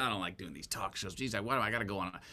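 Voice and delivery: muttered, griping imitation of colleagues; softly voiced mock grumble; mock bitching. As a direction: complain under your breath, dragging your heels. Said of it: "then quit bitching".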